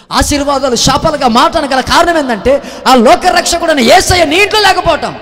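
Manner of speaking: high, loud, slowing on the last line; fiery preaching climax. Acted. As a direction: cry it out to the crowd. Preaching at full force. (ఎత్తైన స్వరంతో గట్టిగా బోధించడం)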